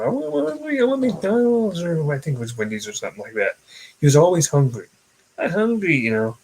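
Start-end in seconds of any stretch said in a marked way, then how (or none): 0.0-1.8 high, drawn-out mock plea for food; higher-pitched pleading kid voice; imitating hungry kid. Voicing the always hungry kid. 5.4-6.0 high-pitched hungry kid impression; lightly voiced hungry kid, pitched up; imitating hungry kid. Voicing the always hungry kid.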